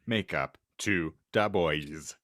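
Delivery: usual speaking voice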